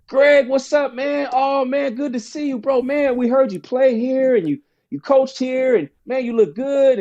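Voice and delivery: high-pitched, hyped-up imitation of old buddies; retold chorus of thrilled pals; excited greeting. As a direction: gush like friends at a reunion. Old friends greet him in the gym.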